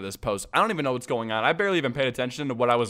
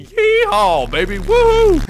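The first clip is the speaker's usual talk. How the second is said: a much higher, much louder yeehaw holler; hammed-up cowboy whoop; 9 dB louder, slower than usual. Joking about country places.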